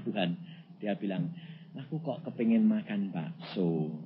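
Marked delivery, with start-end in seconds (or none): 1.7-4.0 soft, low, hesitant request; hint shyly at a craving, imitating the wife; timid wife. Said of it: "ndak berani terus terang".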